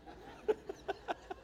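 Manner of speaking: quick airy laugh; breathy laugh. Real, not acted. Pastor amused by the Google search, laughing.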